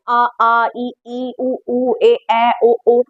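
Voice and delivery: each vowel from आ to ओ held as its own separate sound; reciting Hindi vowels. Shown as a demonstration. Teacher lists the Hindi vowels for students, evenly recited. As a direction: chant the vowels आ, ई, उ one by one, evenly spaced. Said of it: "स्वर आपके ग्यारह होते हैं".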